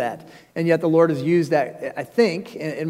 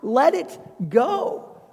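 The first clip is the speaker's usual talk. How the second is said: tender comfort; 11 semitones higher, slower than usual. Acted. Pastor voicing comfort to griever.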